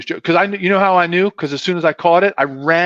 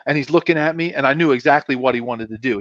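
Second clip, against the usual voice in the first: normal talking voice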